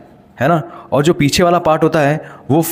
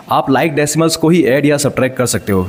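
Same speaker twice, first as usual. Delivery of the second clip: ordinary delivery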